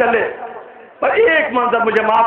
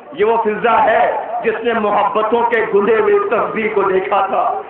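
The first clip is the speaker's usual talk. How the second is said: ordinary talk, nothing marked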